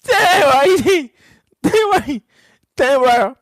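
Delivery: high, loud stammer of disbelief; high, loud, stammering damn; shocked outburst. Real, not acted. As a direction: blurt it out, stunned. Streamer reacts in shock to comment.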